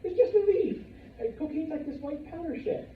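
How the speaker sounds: high, incredulous what?; high-pitched disbelief; shocked disbelief. Retold or imitated. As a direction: sound floored that it's just a leaf. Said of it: "And I was like".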